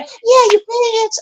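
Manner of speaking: high, slow mock heckle; imitating Kimmy. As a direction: drag the insult out like a chiming sidekick. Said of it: "here's Kimmy in the back".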